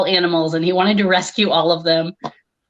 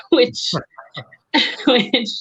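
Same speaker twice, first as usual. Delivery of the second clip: laughing; 6 semitones higher than usual. Real, not acted. Laughs while starting answer.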